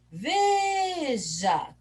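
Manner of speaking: VE in "Veja" stretched long and high; calling from afar. Shown as a demonstration. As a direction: call "Veja" into the distance, holding VE. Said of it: "Vamos chamar longe".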